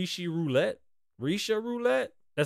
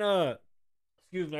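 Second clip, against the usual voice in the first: ordinary delivery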